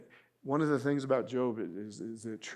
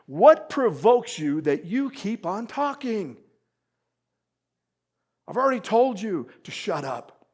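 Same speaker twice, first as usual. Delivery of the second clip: Job's exasperated rebuke; 7 semitones higher, 11 dB louder than usual. Retold or imitated. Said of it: "I love how he says".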